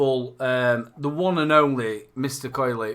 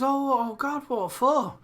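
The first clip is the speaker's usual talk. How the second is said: high, surprised mock neighbour; a touch of a startled neighbour; lightly voiced neighbour; 9 semitones higher, 6 dB quieter, slower than usual. Retelling a joke, neighbour mildly startled, higher voice.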